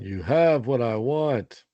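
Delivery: slow, deliberate movie quote; movie line delivery. Pretend. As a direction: say it like the film line. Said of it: "That's what you're supposed to say".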